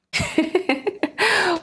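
high, light giggle; real amused giggling. Real, not acted. Storyteller tickled by the silly story, giggling.

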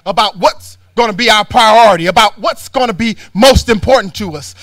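loud, rising preacher's rebuke; preaching at full force. Acted. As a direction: hammer it home from the pulpit. Sermon building to a shout.

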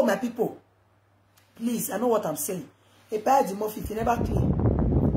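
ordinary delivery

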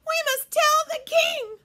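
high, loud mock-alarmed cry; puffed urgent cry. A narrator's reading. Chicken Little urging the others to the king, high panicked cry. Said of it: "puffed Chicken Little".

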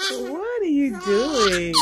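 slow, laughing question. Real, not acted. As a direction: laugh through the words. Laughing at baby's antics.

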